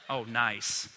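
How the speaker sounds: normal talking voice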